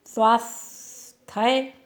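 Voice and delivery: स्वास्थ्य said very slowly with rising end; slow model reading. Shown as a demonstration. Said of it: "slow model reading". (स्वास्थ्य को धीरे, अंत में ऊपर उठाते हुए)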